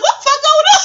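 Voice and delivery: high, loud bewildered bus driver; the driver yelling out in disbelief. Retold or imitated. Bus driver calling out at the quarrelling crowd, loud and high. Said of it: "The bus driver was like".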